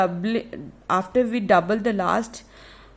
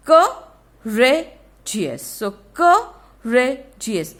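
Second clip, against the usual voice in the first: Courageous stretched very slowly, louder; slow syllable demo; 3 semitones higher, 4 dB louder, much slower than usual. Shown as a demonstration. Teacher to children, slowly spelling out sounds. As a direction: draw out Courageous so each syllable shows. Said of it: "it is having three syllables".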